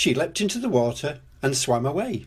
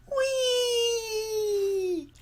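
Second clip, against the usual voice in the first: long, very high held whee; gleeful squeal; 4 dB quieter than usual. Acted. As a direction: squeal it out as if mid-leap. Children leaping into puddle.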